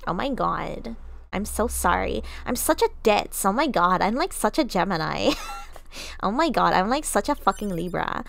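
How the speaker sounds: put-on ditzy, apologetic Valley Girl; Valley Girl accent. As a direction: gush self-mockingly in a Valley Girl voice. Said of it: "Valley Girl accent".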